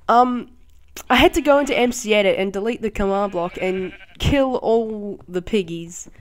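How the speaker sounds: usual speaking voice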